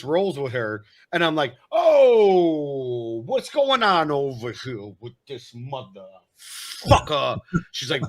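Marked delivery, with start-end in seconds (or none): 1.7-7.4 slow, loud Jersey mobster growl; mobster impression. Shown as a demonstration. Rehearsing roles with his wife, slow mobster voice. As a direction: drag it out like a wiseguy. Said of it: "Tony Soprano or Andrew Dice Clay".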